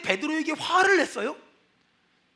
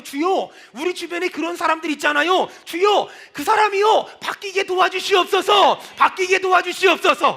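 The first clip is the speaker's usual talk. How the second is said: impassioned prayer; 3 semitones higher, 6 dB louder than usual. Real, not acted. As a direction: plead at full voice, syllables held out. Cries out a prayer to God. (점점 커지는 간절한 부르짖는 기도)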